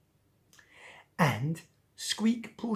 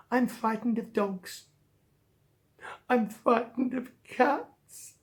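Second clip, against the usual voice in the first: near tears; 5 semitones higher than usual. Acted. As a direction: draw it out as if about to cry. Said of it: "Don't start crying".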